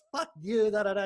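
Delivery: high-pitched mock tirade; retold angry rant. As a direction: replay the fight, pitch jumping up. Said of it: "I got really mad".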